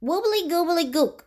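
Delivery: lightly high goblin babble; goblin gibberish. Acted. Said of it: "which is probably".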